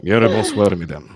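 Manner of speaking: suave Russian-accented greeting, lightly put on; a hint of a Russian accent, charming. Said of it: "on demande un petit coup d'accent".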